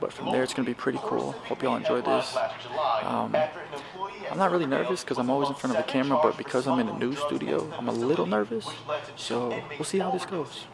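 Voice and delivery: soft hushed voice; whispering. Real, not acted. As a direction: keep it down backstage. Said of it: "I have to whisper".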